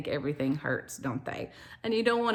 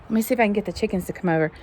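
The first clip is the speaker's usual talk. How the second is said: ordinary talk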